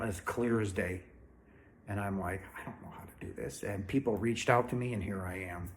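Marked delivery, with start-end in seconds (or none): 2.6-3.6 a soft, quick unvoiced whisper. Retelling her reaction to Father's message, in a quick whisper. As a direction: breathe it out fast, as if overwhelmed.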